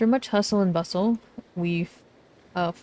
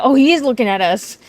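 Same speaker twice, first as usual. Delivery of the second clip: much louder, higher surprised exclamation; sudden loud realization; startled outcry; 5 semitones higher, 10 dB louder, faster than usual. Acted. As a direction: shout it as you catch him staring. Character startled by fourth wall.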